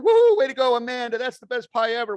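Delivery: high, loud gushing cheer over a friend's pie; mock cheering. Friends cheering Amanda's pie on Facebook, gushing loud cheer. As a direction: cheer her on like a hyped-up fan. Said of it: "We're all like".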